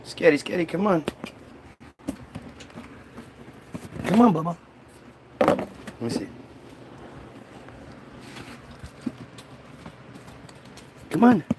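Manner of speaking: soft, coaxing call to the cat; coaxing the pet. Real, not acted. Speaker softly coaxing the pet to come over. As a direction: gently coax the pet to come.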